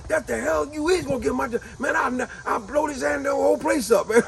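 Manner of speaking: high, angry ranting boss; an angry man raging at his bosses, hammed-up and high; furious rant. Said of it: "So old boy just got furious".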